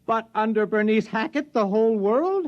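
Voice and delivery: aghast as it dawns on him; horrified at a world under Bernice; alarmed disbelief. Acted. Scientist alarmed at world takeover.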